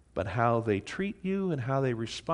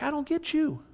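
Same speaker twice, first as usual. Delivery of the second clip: God baffled. Retold or imitated. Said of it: "And God's like".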